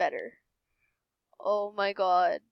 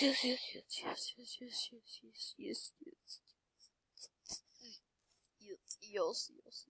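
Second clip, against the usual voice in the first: rapid-fire voiceless repeats, fading; whispered celebration; 16 dB quieter than usual. Real, not acted. Player privately rejoicing over the million, fading excited whisper.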